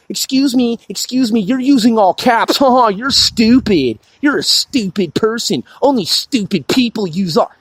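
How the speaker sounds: taunting caricature of a know-it-all complainer; mocking thought police. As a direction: imitate a sneering online nitpicker. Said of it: "You're like".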